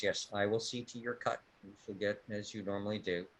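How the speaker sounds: soft, low-key knight reply; lightly voiced knight, speaking softly; quiet knight. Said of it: "And Thorne says".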